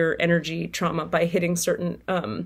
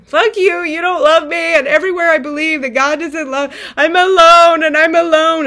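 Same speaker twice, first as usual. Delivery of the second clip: higher, much louder raging shout; hurt and furious, yelling at God from the car; furious yell at the universe; 8 semitones higher, 7 dB louder than usual. Retold or imitated. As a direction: scream your fury up at the sky. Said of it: "and I'm yelling".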